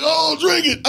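high, loud surprised realization; mock realization. Comic at home spotting his own habit, surprised and high. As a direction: catch yourself, voice shooting up. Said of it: "I'd be at home like".

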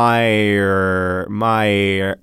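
Meyer stretched long with a full R; drawn-out pronunciation. Shown as a demonstration. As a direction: draw out "Meyer" slowly to rhyme with fire. Trying the name's odd pronunciation.

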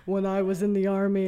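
mock ad voiceover. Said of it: "That's the voiceover he should do".

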